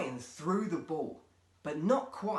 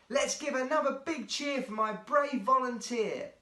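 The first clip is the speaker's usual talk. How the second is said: announcing; 10 semitones higher, 6 dB louder than usual. Acted. Showman announcing into microphone.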